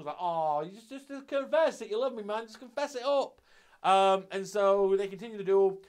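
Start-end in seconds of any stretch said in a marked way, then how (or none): 0.2-3.4 higher, pleading put-on voice; imitating someone overcome with feeling; mock emotional. Ai urging him to confess his love, mock emotional. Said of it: "it made I all emotional".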